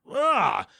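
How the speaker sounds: high imitated grunt of disgust; angry grunt. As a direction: grunt like a fed-up customer. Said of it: "an angry grunt".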